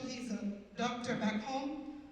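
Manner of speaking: usual speaking voice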